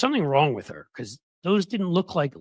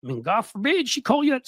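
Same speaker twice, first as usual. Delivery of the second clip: normal talking voice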